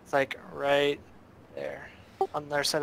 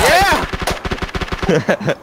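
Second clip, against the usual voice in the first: loud, high triumphant shout; victory yell; 9 semitones higher, 10 dB louder than usual. Real, not acted. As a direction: cheer the win. Match won, celebrating.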